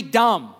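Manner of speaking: short falling stress on "dumb"; punched dumb. Shown as a demonstration. Preacher punching "dumb" to set up the pun. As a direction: land hard on "dumb" as a pun. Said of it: "D U M B".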